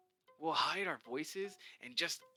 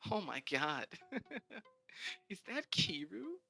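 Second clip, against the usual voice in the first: mocking laugh; 8 semitones higher than usual. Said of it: "Mandy says".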